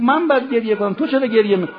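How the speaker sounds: impassioned plea. Acted. Preacher voices dying Abbas to weeping Imam, impassioned plea. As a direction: plead loudly with the pitch lifted. Imam's quote in mourning sermon.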